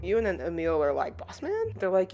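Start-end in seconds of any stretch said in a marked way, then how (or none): 1.2-1.7 high, softer questioning voice; doubtful sidekick. Retold or imitated. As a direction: question the boss with a steep rise. Voicing two sidekicks doubting their boss.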